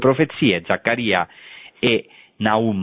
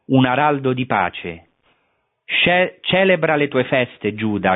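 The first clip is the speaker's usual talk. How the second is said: raised, solemn reading of the prophecy; scripture reading; 4 semitones higher than usual. Acted. Reads the Nahum prophecy aloud. (lettura solenne della profezia)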